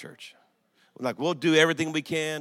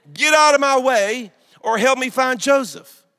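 desperate demand; 9 semitones higher, 9 dB louder than usual. Retold or imitated.

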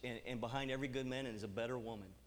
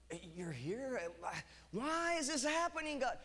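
higher complaining plea to God; whiny complaint; 10 semitones higher, 3 dB louder than usual. Retold or imitated. Preacher replaying his own complaint to God, whiny and higher. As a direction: whine it up at God. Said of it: "dialogue with God retold".

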